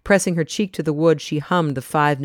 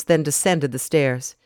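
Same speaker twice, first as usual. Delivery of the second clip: usual speaking voice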